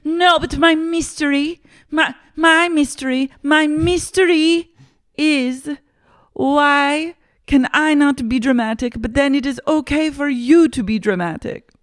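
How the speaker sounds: very high, hammed-up whine of protest; Whitney, protesting. Housewife posing her mystery question, high mock-indignant voice. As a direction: insist on being heard, stumbling in a put-on high voice. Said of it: "Whitney goes".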